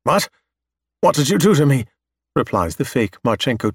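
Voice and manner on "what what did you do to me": higher, alarmed questioning; scared and demanding answers; fearful. Acted. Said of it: "The fear is still audible".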